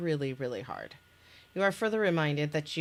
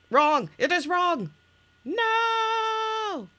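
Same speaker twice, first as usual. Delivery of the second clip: high, loud outraged yell; Picard yelling; 13 semitones higher, 7 dB louder, much slower than usual. Retold or imitated. Reading out a gif in the game, yelling. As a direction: yell it, drawing out the final no. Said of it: "of Picard, uh, yelling".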